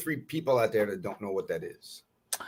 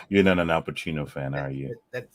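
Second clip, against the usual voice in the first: ordinary talk, nothing marked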